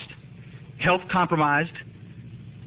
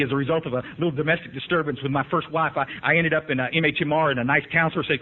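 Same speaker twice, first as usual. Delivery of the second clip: usual speaking voice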